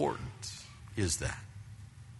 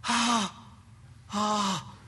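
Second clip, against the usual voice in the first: high, strained mock-polite ah; forced polite ah; 10 semitones higher than usual. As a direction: act politely unbothered while annoyed. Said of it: "And I go".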